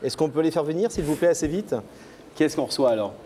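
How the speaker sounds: usual speaking voice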